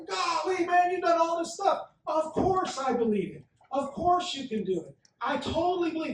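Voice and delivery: high, loud gushing fans; gushing crowd. Retold or imitated. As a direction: gush like a crowd that can't believe its eyes. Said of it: "And the crowd's like".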